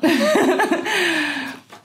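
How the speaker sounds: bright high laugh. Real, not acted. Admitting past burnout to viewers, high laugh. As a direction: crack up at yourself.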